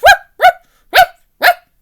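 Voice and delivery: very high, loud yapping barks; sharp barking ruffs; dog bark. Acted. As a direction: bark like an excited little dog. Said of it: "The dog barked".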